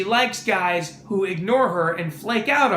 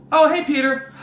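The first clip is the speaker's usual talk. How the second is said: phone call answerer; 6 semitones higher, 4 dB louder than usual. Acted. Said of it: "it would go like this".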